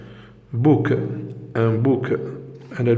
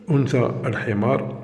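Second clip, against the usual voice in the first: ordinary talk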